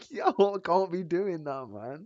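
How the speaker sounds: high, loud laughing hype; loud amused disbelief; frat bro. Real, not acted. Laughing hype after win.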